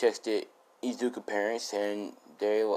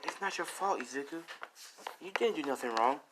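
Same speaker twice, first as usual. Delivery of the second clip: softer, higher reassurance; comforting; 5 semitones higher, 10 dB quieter than usual. Retold or imitated. Girl reassuring Izuku it is not his fault, soft. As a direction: soothe him gently.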